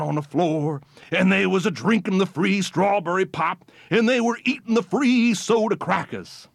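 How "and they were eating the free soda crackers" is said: usual speaking voice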